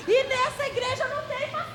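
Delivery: very high, softened mock wail; small, high-pitched meltdown voice; imitated child's tantrum. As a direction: squeeze out a child's protest, high but held back. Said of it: "fazer assim".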